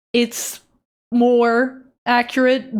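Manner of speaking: slow and deliberate for the camera; careful slow enunciation; slow articulated. Shown as a demonstration. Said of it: "if I articulate".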